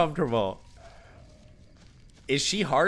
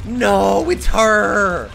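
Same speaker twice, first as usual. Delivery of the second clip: loud, drawn-out cry of dread; alarmed cry; 3 semitones higher, 8 dB louder, much slower than usual. Real, not acted. Player spotting the chainsaw lady, alarmed loud cry. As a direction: wail it out in alarm.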